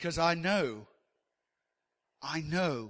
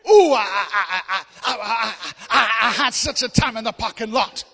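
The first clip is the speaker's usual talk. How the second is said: high, loud stammering preacher voice; mock preacher overdoing the drama; hammed-up preacher; 4 semitones higher, 10 dB louder than usual. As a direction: stutter it out like a worked-up preacher. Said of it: "as long as he does it at home".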